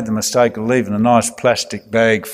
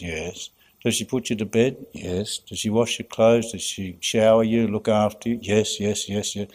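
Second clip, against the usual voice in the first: put-on low, much softer child replies; small child's quiet yeses; 3 semitones lower, 6 dB quieter than usual. Imagined child quietly admitting mum cares for her. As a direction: mumble them like a sheepish little kid. Child answers priest's questions.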